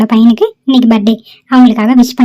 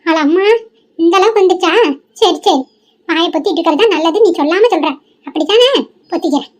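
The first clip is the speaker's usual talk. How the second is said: hurt grandmother; 5 semitones higher, 4 dB quieter than usual. Acted. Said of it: "இங்க பாரு பாட்டி".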